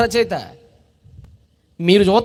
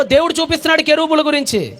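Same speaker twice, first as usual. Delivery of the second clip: normal talking voice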